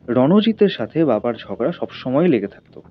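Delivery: usual speaking voice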